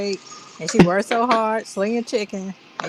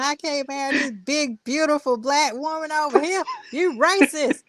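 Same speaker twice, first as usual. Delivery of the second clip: higher, indignant put-on rant; mock outraged homeowner; 8 semitones higher than usual. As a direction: play the offended fake owner, higher.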